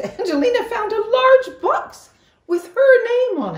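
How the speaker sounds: high, loud gleeful laugh into the words; excited. A narrator's reading. Angelina finds the box with her name, laughing and excited. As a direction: burst with delight at the present.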